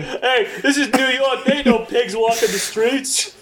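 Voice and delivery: high, slow, laughing New York tough; a hint of a brash New Yorker; New York accent.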